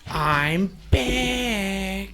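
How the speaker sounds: lightly voiced cartoon kid; a hint of a South Park kid; Kyle impression. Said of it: "I'm like other Kyle from South Park".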